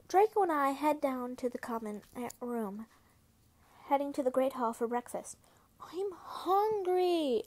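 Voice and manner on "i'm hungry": drawn-out whine, pitched up; whiny. A narrator's reading. Septimus whining to Draco for food. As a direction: whine it like a hungry kid. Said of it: "I whined to Draco".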